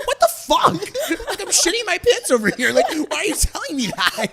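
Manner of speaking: high, loud, laughing mock freak-out; retold panic. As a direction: yell it in outraged alarm, cracking up. Retells own panic as sick child.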